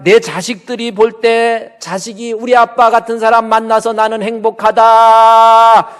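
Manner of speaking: loud, high, stretched-out declaration; emphatic preaching cry. Acted. Urging deacons to examine how their children see them, loud emphatic cry. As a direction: proclaim it at full force, drawing out the end.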